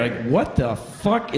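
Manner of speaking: usual speaking voice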